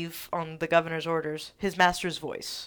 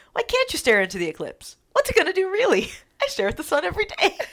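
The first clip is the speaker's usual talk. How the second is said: quoting Carlton; 11 semitones higher, 4 dB louder than usual. Retold or imitated. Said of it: "Carlton asks".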